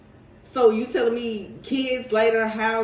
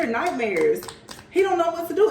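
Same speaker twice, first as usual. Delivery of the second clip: ordinary talk, nothing marked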